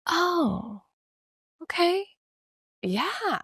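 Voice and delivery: high, soft resigned mother; mom conceding. Retold or imitated. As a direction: give in with a mild sigh. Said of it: "And she's like".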